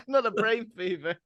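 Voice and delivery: high, loud, laughing; laughing mock Victorian shock; laughing mock horror.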